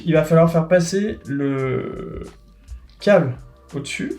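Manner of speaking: usual speaking voice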